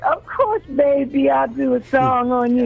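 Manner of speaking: imitating Curtis, high and sweet, Liverpool-free soul croon. Imitating [name] agreeing to produce her, high sweet coo. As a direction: lift way up into a tender falsetto, cooing. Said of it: "Curtis was like".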